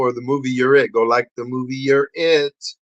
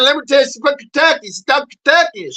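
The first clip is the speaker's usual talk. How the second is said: Southern accent; 10 semitones higher, faster than usual. Shown as a demonstration. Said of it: "Southern accent".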